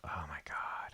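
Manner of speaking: hushed frustrated whisper. Real, not acted. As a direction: breathe it out under your breath. Frustrated muttering at the computer.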